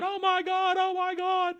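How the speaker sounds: very high, thin falsetto panic; mock freaking out. Everybody facing the reality outside, mock panicked squeal. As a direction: squeal in comic alarm. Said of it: "Everybody's freaking out".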